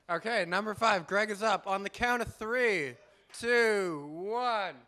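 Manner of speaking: host hyping the room for the next poet; announcing countdown. Real, not acted. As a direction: call the countdown out to the crowd.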